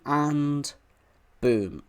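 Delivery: ordinary talk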